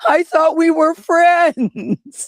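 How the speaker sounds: high, drawn-out pitiful plea; mock-pathetic high whine, very slow. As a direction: wail it pathetically, stretching the words. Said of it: "it sounded so pitiful".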